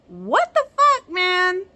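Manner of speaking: outraged disbelief. Real, not acted. Outraged at a drama character.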